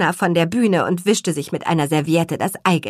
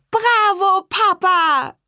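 cheering; 12 semitones higher, slower than usual. Acted. Twins cheering their father's juggling, high and excited. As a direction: cheer for dad like a delighted child. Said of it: "riefen die Zwillinge".

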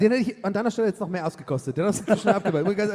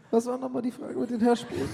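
ordinary talk

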